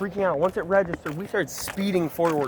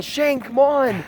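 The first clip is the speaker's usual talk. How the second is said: higher, drawn-out mock yell; retold scream; 7 semitones higher, 4 dB louder, much slower than usual. Rider screaming to a friend to hurry, imitated yell. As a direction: call out a stretched, raised imitation of screaming.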